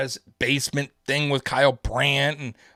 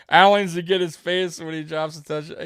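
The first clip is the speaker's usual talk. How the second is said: ordinary delivery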